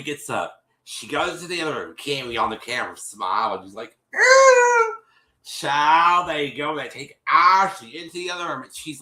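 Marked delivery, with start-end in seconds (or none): 4.1-4.9 very high, loud mock squeal; mimicked squeal. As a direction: imitate her with a piercing held squeal. Mimics Cammy's reaction on camera.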